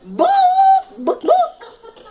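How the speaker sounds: high, loud clucking book book; squawking chicken voice; clucking hen. Acted. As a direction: cluck the words like a frantic hen. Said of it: "clucked to the top of her lungs".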